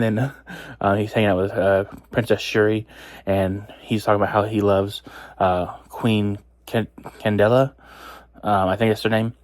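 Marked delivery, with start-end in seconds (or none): none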